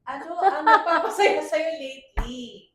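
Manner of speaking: high, slow, booming question; loud emphatic question. Real, not acted. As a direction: belt out the question, drawing it slowly. Laughingly recalls the forgotten question. (malakas at madiing tanong, mabagal)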